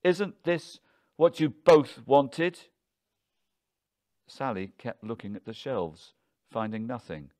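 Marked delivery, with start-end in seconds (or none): 0.0-2.7 slow, loud, high-pitched demand; emphatic, drawn-out angry challenge; frenzied. A narrator's reading. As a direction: hurl the question at both, word by word. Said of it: "in something of a frenzy".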